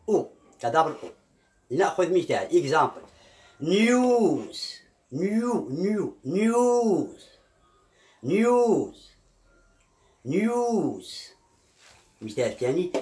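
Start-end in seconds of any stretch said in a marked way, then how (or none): 3.6-11.3 نيوز higher and slow, with a long "oo"; double-o sound. Shown as a demonstration. Teacher models the second case, drawn-out double-o. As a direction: stretch the "yoo" in نيوز, word by word. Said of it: "الحالة الثانية تنطق ك-، كدبل أو".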